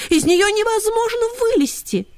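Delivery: put-on high, whiny girlish voice; greyhound girls voice. A narrator's reading. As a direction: whine it like young hounds. Девочки продолжают. (высокий девичий голос)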